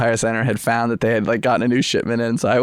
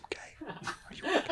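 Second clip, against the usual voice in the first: nearly silent, voiceless mouthing; mouthed words; 19 dB quieter than usual. Retold or imitated. Secretly checking on a woman in a car, mouthed. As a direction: barely let a breath through, just mouth it. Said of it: "Mouth into my camera".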